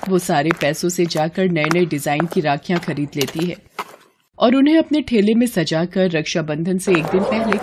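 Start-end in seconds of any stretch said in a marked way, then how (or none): none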